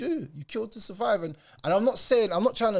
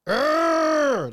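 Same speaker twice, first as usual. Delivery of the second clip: a sharp, high, loud disgusted groan; exasperated groan; 10 semitones higher, 7 dB louder than usual. Real, not acted. Fed up reacting to the documentary, loud exasperated groan. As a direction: let the frustration burst out as a groan. Fed up while watching the video.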